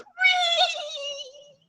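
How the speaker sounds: 1 horse squeal. Acted. Horse sound in song.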